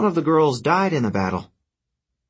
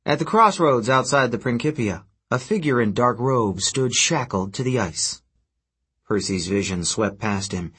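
plain reading voice

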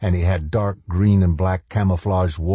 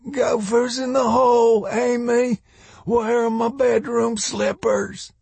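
put-on very high-pitched delirious old man; old man speaking loudly. A narrator's reading. Delirious old man calling to Amy, loud and high. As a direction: call out in a high, cracked-up old man's voice. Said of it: "Then he said loudly".

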